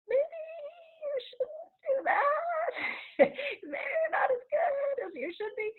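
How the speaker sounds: high, quavering mock worried parent. Parent voicing protective worries.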